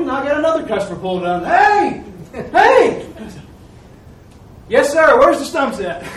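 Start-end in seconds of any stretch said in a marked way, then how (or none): 1.4-3.1 loud, high holler edged with laughter; calling out. Retold or imitated. Another customer pulls up as grinder starts, calling out. 4.7-6.0 loud, high and laughing; eager. Retold or imitated. Stump grinder answers the newest customer, eager.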